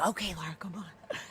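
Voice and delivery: soft, whispered coaxing; whispered plea to leave; hushed urging. Retold or imitated. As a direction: gently pull a friend away. Protester quietly pulling her away.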